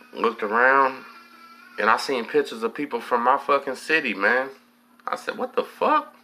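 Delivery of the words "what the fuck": sudden high, stunned cry of disbelief; retold shock on seeing familiar faces. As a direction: blurt it out, caught completely off guard. Finding hometown pictures in cell.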